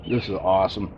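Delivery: ordinary talk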